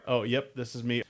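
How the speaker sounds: usual speaking voice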